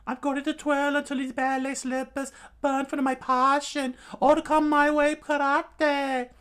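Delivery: hammed-up balletic battle speech; flamboyant villain declaiming. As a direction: proclaim passion in a girlish squeal. Villain declaims while pirouetting in disguise.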